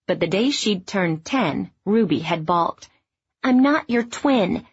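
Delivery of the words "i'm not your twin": put-on higher, slow, a child digging in her heels; slow defiant little-girl voice; lightly voiced child refusing; stubborn young girl. A narrator's reading. Child Ruby balks at mother.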